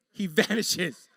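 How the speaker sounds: usual speaking voice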